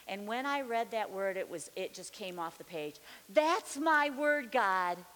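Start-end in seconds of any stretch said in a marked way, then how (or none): 3.3-5.0 loud, high, drawn-out exclamation; excited claiming. Retold or imitated. Believer claiming a scripture before God, excited. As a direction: seize the promise out loud.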